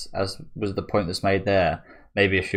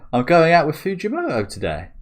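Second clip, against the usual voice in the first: much higher and louder; indignant girl; 11 semitones higher, 5 dB louder than usual. Acted. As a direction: protest like a teenage girl. Daughter protesting.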